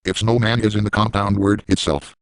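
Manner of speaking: ordinary talk, nothing marked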